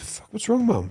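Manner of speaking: high, soft worried question; imitating a worried son asking gently; retold concern. Son finds his mum crying.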